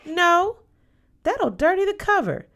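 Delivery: high, loud no from a fussy aunt; sharp refusal. Acted. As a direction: snap it out to protect the furniture. Aunt scolds niece about sofa.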